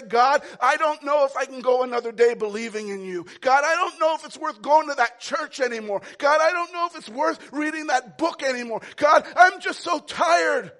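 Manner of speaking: loud, exasperated pleading with God; angry cry to God; frustrated prayer. Retold or imitated. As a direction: voice the weary believer's complaint. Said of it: "where you say".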